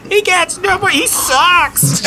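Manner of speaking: very high put-on heckle; jeering heckler. Merchant jeering at the weeping opponent, shrill heckling. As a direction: squawk it out from the crowd.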